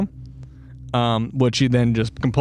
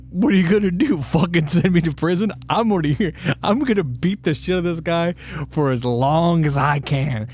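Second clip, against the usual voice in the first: higher, taunting inmate voice, lightly put on; tough inmate; 5 semitones higher than usual. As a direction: sneer like a lifer who has nothing to lose. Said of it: "And he's like".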